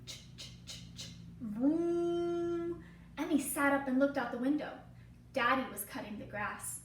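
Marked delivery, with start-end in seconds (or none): 0.0-1.1 soft unvoiced chugging; mower pull-start noise. Lawnmower starting. 1.4-2.8 steady droning vroom; engine vroom. Acted. Lawnmower engine.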